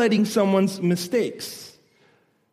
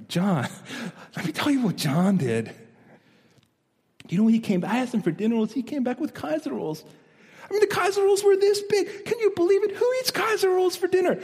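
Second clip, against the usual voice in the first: gossiping wife, rising much higher as she gets worked up; a mock-outraged wife telling friends about her husband; complaining wife; 8 semitones higher than usual. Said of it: "how do you tell it".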